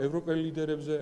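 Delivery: heated, insistent rise in volume. Real, not acted. Raising voice over interviewer.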